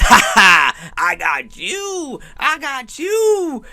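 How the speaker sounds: high, gloating villain impression with a drawn-out YOU; mock-cackle, then crow it high and slow; Penguin. Penguin thinks he got Batman, mock triumphant crowing. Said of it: "he thinks that he got Batman".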